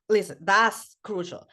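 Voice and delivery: usual speaking voice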